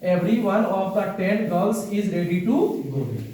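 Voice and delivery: every word slow and clear for writing; slow dictation. Shown as a demonstration. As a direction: read it out slowly so they can write. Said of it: "तो इसको लिखिए". (धीमे-धीमे लिखवाते हुए)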